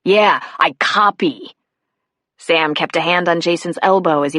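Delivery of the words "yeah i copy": slow, disgusted grudging reply; total disgust. A narrator's reading. Boy answering the cop's threat, slow and disgusted. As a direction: drag the words out in disgust. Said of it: "he said in total disgust".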